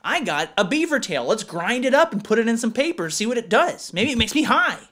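high, loud goofy voice; dumb excited caveman. Acted. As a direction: pitch it up like a clueless caveman. Voicing a primitive human.

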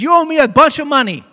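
high, loud creditor's demand. Acted. King to his debtor, demanding payment loudly.

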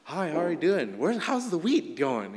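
sing-song, put-on friendly greeting; friendly hello. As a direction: play the harmless farmer with a smile. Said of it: "put a smile on their face and said hello".

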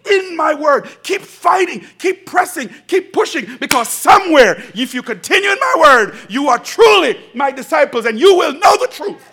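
shouted, high and loud pleading; impassioned exhortation. Acted. Voicing Jesus urging the church, shouting. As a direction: cry it out like a rallying call. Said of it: "And Jesus is saying".

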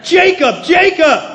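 loud, high summons by name; parent calling sharply. Acted. Preacher calls a name as a parent would, loud and urgent. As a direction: call the name twice like a parent who means it. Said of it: "you're probably not a parent".

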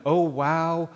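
drawn-out loud, awed exclamation; hushless, full-voiced retold amazement; retold dying man's wonder. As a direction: imitate someone awestruck by a sight, each repeat trailing longer. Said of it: "He went".

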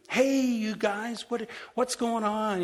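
high, drawn-out friendly hello; mock bright greeting to friends at supper; cheery greeting.

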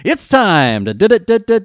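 stuttering on d, climbing high and loud; hammed-up stammer on d; mock stutter on d. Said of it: "I got the wrong kind of stutter".

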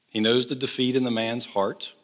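usual speaking voice

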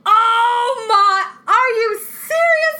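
high, loud panicked disbelief; shocked alarm. Retold or imitated. Mother opening the diaper, high shocked outcry. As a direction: cry out in horror at what you see.

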